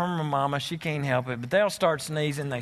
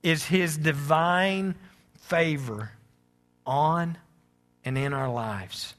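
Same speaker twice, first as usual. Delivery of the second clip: reflective sermon; 3 semitones lower than usual. Acted. Quiet reflective sermon close.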